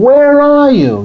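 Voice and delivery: long high calling scream. Retold or imitated. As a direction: call across miles of emptiness. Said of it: "I scream".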